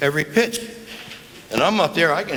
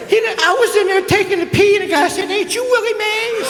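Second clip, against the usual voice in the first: very high, laughing put-on voice; high-pitched giggly Willie voice, much higher; Willie Mays impression. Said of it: "Here's the way Willie is".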